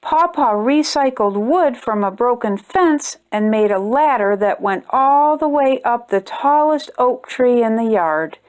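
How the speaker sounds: plain reading voice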